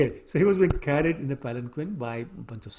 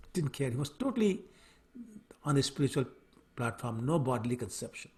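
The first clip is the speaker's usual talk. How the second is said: usual speaking voice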